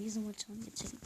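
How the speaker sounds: usual speaking voice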